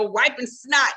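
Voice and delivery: ordinary talk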